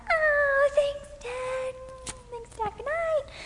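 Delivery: put-on high, childish thank-you; little girl. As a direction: sound like a sleepy little girl.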